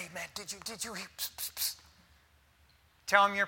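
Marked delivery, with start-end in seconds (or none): 0.0-1.8 mock gossiping whisper with hissing pss. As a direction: hiss it like passing a secret. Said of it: "When someone comes up and say".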